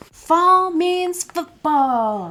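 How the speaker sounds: high, loud, very slow stadium announcer call; sports announcer. Acted. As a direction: drag it out like a game announcer. Announcing the football page.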